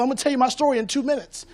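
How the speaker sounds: normal talking voice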